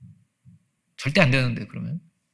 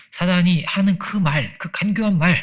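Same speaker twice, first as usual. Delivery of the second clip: rising intensity; 6 semitones higher, 5 dB louder, slower than usual. Acted. Preacher voicing Satan's words.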